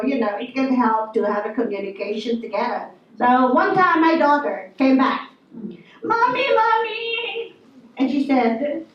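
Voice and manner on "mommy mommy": put-on high child's voice calling mommy; lightly voiced little girl calling out. Daughter running home calling her mother.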